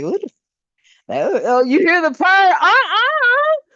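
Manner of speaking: gasping delight at the chills; thrilled, giddy shock; excited squeal. Real, not acted. Reacting to spirit giving chills.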